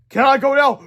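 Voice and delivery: high, loud nervous plea; pleading. Acted. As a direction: beg to leave. Principal pleads to leave.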